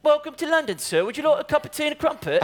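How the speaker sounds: put-on posh British accent. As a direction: play a courteous Londoner, lightly. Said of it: "Un bon accent anglais".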